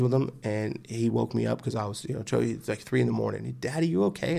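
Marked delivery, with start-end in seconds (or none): 3.6-4.4 put-on higher, gentle little-boy question; child's concern. Young son checking on his dad at three a.m., concerned. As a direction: ask Dad softly, like a small child.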